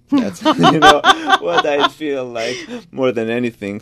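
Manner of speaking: amused laugh breaking through the words. Real, not acted. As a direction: laugh it off lightly while talking. Laughs at own self-description.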